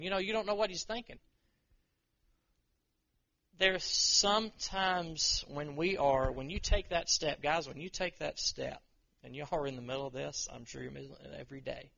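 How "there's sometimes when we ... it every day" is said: ordinary talk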